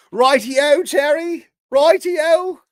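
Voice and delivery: high, loud hammed-up English righty-o; teasing Cherry in mock British voice; mock English accent. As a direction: toss off a cartoon British righty-o.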